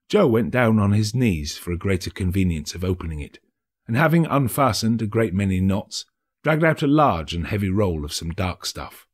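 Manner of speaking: usual speaking voice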